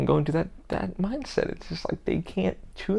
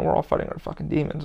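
normal talking voice

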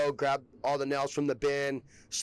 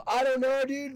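high, louder, sheepish excuse; mock flustered self; 11 semitones higher, 4 dB louder than usual. Young laborer answering his boss after the crash, high flustered excuse. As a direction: play your younger self caught out.

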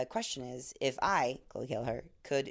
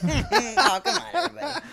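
laughing out loud, much higher and louder; 12 semitones higher, 11 dB louder than usual. Real, not acted. Cracking up at a friend's rude answer, laughing loudly. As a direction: burst out laughing through the words.

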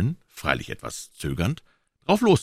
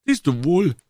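high-pitched, eager exclamation; high, excited kid; triumphant boyish cry; 11 semitones higher, 4 dB louder than usual. A narrator's reading. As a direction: call it out as a vindicated boy. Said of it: "rief Joe".

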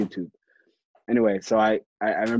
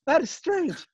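mock amazement; 11 semitones higher, slower than usual. Retelling his own amazed reaction.

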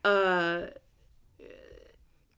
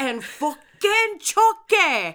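mock wail; 6 semitones higher, 5 dB louder, slower than usual. Running Chucky gag.

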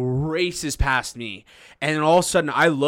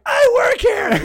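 very high, loud yelp; hammed-up desperate yell; panicked mock shout; 8 dB louder, slower than usual. Terrified young server to the man he holds, panicked shout.

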